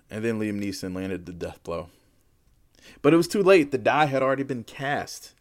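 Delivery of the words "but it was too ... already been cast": booming doom; dramatic loud. A narrator's reading. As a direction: announce doom loudly. Dramatic reveal.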